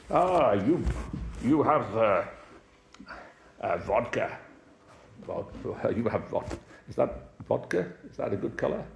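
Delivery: a hint of a jovial Russian captain offering vodka; mock Russian accent. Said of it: "Russian man".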